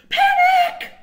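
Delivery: shrieking high and loud; panicked scream. A narrator's reading. Step one read out as panic.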